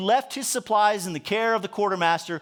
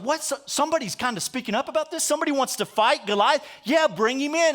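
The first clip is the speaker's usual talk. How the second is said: higher, quick mock-surprised king; surprised eager Saul; 6 semitones higher than usual. Preacher voicing Saul hearing of a volunteer, surprised. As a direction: perk up like a relieved king. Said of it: "And so Saul's like".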